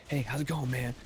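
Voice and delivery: bubbly and over-friendly, imitating a peppy guy. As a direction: put on an upbeat, eager host voice. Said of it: "This, like, weird peppy guy".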